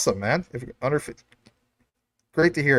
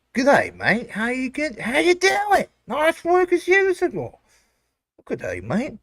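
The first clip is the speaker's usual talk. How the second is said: mock Australian accent; 11 semitones higher than usual. Streamer greeting chat members in a put-on Australian accent. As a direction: greet the chat like a hammed-up Aussie. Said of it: "Bro, you don't even sound like us".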